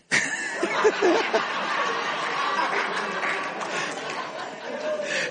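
high wheezing laughter; helpless laughing fit; breathless silent laugh. Real, not acted. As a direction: soundless giggling. Laughing at own joke.

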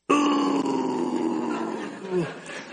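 sudden startled inhale; shocked intake of breath; sharp gasp. Retold or imitated. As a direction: gasp as if caught out. Spirit convicting him at the dump.

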